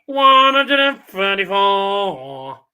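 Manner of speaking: darts score caller. Retold or imitated. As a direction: draw out each word like the caller. Mimicking caller, drawn out.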